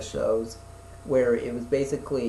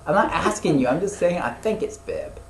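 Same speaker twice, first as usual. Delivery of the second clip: higher, emphatic comeback; mock-exasperated correction; 6 semitones higher, 4 dB louder than usual. Real, not acted. Host telling friend he wasn't asking, exasperated. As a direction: insist on it, pitched up and punchy.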